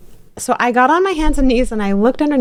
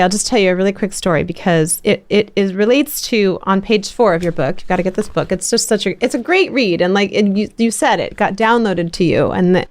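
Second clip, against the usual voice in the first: usual speaking voice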